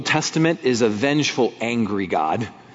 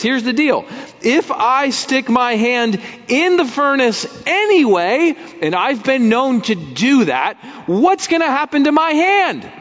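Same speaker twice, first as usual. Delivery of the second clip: higher, driving sermon delivery; impassioned preaching; 9 semitones higher than usual. Acted. Preacher urging congregation, impassioned full-force preaching. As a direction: preach it with rising fire.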